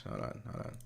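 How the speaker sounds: quick hushed aside. Real, not acted. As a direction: murmur it to yourself while busy. Streamer's quick aside off mic.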